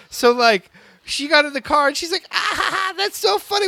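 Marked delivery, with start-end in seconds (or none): 2.3-3.7 very high-pitched, mocking amusement; teasing sister. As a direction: imitate a sister delighted by her prank. Voicing sister gloating at the prank.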